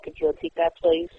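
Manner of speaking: ordinary delivery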